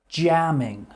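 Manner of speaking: "Jamming" said loud again, JAM-ming, falling; model word repeat. Shown as a demonstration.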